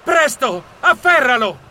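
loud, high-pitched urgent cry; terrified. A narrator's reading. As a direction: shout for her to grab it, in terror. (grido terrorizzato)

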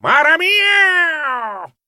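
very long, high yowl; cat-like cry. A narrator's reading. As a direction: stretch the cry into a falling meow. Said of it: "saltò via agilissimo gridando".

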